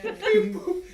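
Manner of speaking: high, loud, laughing beeps; robot beeps. Acted. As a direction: chirp it like a droid. Mimics robot noise.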